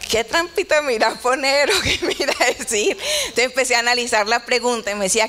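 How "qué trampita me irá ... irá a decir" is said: laughing through a wary thought, higher toward the end; amused inner thought. Retold or imitated. Pastor recalls wondering what trick question the doctor has, amused. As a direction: retell the silent suspicion with a laugh.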